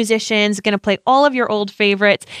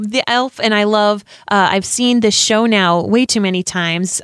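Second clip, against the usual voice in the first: ordinary talk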